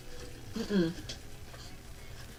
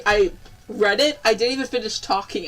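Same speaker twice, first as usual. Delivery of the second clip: ordinary delivery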